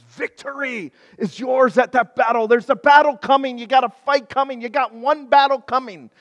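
loud, triumphant declaration; proclaiming shout. Retold or imitated. Dying Elisha proclaiming victory to the king, shouting. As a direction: announce the victory with force. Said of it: "I had to shout at you".

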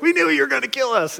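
high, fast wail of accusation; mock panicked kids. Kids to the driver as boat fills, mock panicked accusation. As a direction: squeal it in a rush like kids.

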